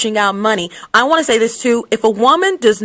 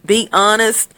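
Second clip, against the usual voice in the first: usual speaking voice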